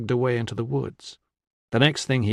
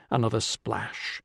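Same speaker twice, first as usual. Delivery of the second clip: plain reading voice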